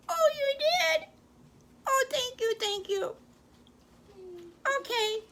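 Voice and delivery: squeaky-high storybook grandmother; grandma voice. Acted.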